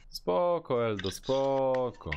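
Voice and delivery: high, stretched "Spoko", then slow "[name], spoko"; playful drawn-out spoko. Real, not acted. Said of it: "Jak cię tam plecka bolą". (przeciągnięte, wysokie "spoko" żartobliwie)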